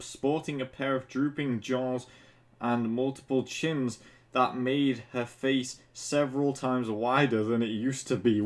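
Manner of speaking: usual speaking voice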